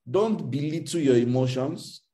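ordinary delivery

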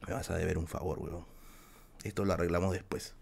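quiet, low, resigned deal-making; grudgingly giving in, half under breath; resigned mutter. Retold or imitated. Telling Juancito he owes him one, quiet and resigned. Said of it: "y yo le digo".

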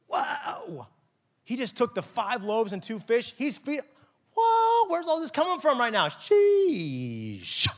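high, loud whoa of amazement; amazed crowd. Retold or imitated. Crowd seeing the loaves multiply, shouting in awe. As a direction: gasp it like a stunned crowd.

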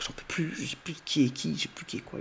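high, strained voice cracking under the strain; breaking down. Retold or imitated. Ben cracks after the clown's trick, strained breaking voice. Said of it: "il craque".

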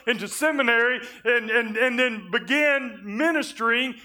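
full-force preaching. Acted. Pastor retelling God's rebuke to his church, full-force preaching.

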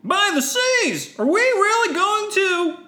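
high, loud, drawn-out cry; alarmed Jedi. Acted. Jedi sees a weapon drawn, high alarmed cry. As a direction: exclaim in hammed-up alarm.

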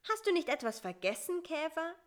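high, quick, wondering question; puzzled. A narrator's reading. Fly stops the departing beetle, puzzled. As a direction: ask in surprise, pitched well up. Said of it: "fragte Bissi verwundert".

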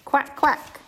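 gentle singsong read-aloud; reading to a toddler. Acted. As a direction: read it sweetly to little kids. Reads picture book to toddlers.

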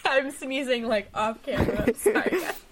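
giggling through an apology; laughing. Real, not acted. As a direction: laugh while saying it.

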